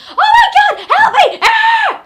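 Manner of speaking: hammed-up screaming with a laugh; mock panicked scream. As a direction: shriek like waking from a nightmare. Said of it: "you freak out and you scream".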